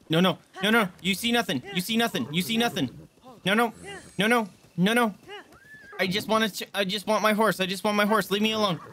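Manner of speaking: high, repetitive nervous pleading; mock-panicked pleading. Player begging a Lynel to ignore him, playful panic. Pleading with scary monster in game.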